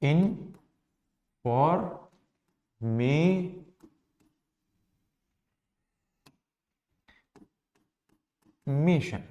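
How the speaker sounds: इन, फॉर, मे, शन with long gaps; very slow information, each syllable on its own. Shown as a demonstration. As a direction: break information into separate syllables. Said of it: "इसमें कितने syllable हो जाएंगे".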